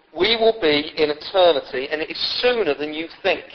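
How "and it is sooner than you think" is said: usual speaking voice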